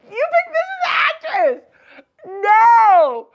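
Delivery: high, incredulous outburst; outraged shout. Real, not acted. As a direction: shout it in high-pitched disbelief. Outraged reaction to a picture shown.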